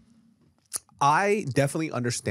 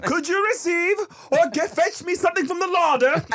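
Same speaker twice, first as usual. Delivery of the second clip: put-on very high, formal British baby; British-accented baby; 4 dB louder than usual. Baby sending nanny to fetch food, high British accent. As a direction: request it grandly in squeaky British. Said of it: "busted out with this British accent".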